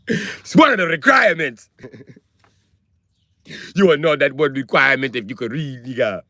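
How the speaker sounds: mock African accent, scolding; caricatured Wakandan African accent. Wakandan stating the reading requirement, mock African accent. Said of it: "In Wakanda, you must read".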